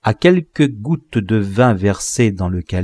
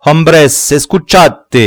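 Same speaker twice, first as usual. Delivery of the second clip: calling out; 6 semitones higher, 7 dB louder than usual. A narrator's reading. Sorcerer calls the crowd to listen, loud. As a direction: summon the crowd.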